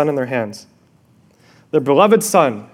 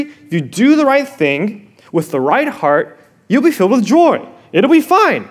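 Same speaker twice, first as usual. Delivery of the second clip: ordinary talk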